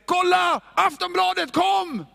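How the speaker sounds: a hammed-up tabloid yell; mock shout. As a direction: shout it out like gossip news. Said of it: "den där som skrek".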